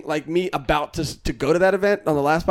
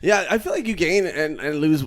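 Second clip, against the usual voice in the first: ordinary delivery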